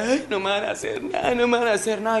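high-pitched mock whimpering victim; imitated crying plea. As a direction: plead like the frightened old woman, high. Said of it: "la cucha lloraba y era así". (súplica llorosa imitada, voz aguda)

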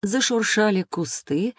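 normal talking voice

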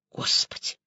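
unvoiced, shocked whisper; faint babble. A narrator's reading. Woman learning who the victim was, faintly babbling. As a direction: gasp it out very softly. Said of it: "залепетала я".

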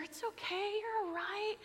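high, soft soothing voice, gently; calm a frightened stranger tenderly; reassuring. Retold or imitated. To a hurt old man on the sidewalk, soft high soothing.

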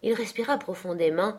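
usual speaking voice

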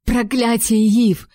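a curse flung at him; furious exclamation. A narrator's reading. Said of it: "воскликнула я".

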